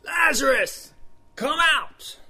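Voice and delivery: high, loud holler; yelling into a cave. Acted. Teacher acting Jesus in front of the cave, yelling. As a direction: call out far into a cave. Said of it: "yelling into the cave".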